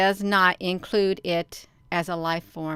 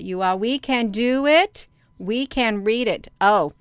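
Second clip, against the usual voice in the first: ordinary talk, nothing marked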